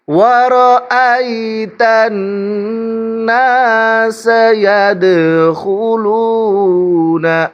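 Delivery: melodic, drawn-out tajweed chant; Quran recitation. Shown as a demonstration. Teacher recites for students, in melodic Quran recitation. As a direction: recite slowly with a lilting tune.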